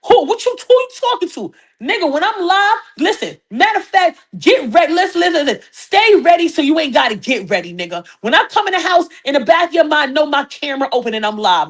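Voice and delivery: G-checking a man. Pretend. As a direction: put him in his place like you run the house.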